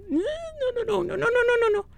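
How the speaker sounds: high, rapid string of no's; panicked no-no-no. Real, not acted. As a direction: panicky high-pitched repeating, building. Panicking as the game goes wrong.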